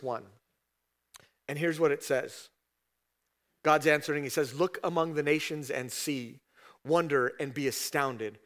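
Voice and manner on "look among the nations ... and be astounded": quiet, measured reading of the verse; solemn Bible reading, softer; scripture reading. Acted. Reads scripture as God's words.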